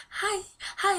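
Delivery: high, soft cooing hello; tiny high-pitched greeting; tickling baby talk. Retold or imitated. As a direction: coo playfully while tickling a baby.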